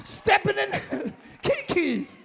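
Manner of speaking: loud, rousing call to the church; full-force preaching. Acted. Preacher to congregation calling them blessed, rousing. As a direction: lift them up and shout it.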